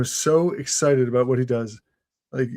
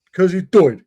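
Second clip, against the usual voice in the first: higher, louder English punchline; tour guide's English accent; 4 semitones higher, 6 dB louder than usual. Retold or imitated. Visitor repeats guide Simon's punchline, English accent. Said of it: "That's how his accent is".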